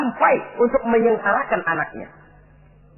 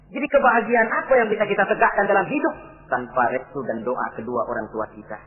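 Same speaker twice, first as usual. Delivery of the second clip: usual speaking voice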